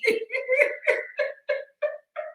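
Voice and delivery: high shrieking laugh. Real, not acted. Laughing at her own jail story, high shriek. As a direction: burst into a high cackle.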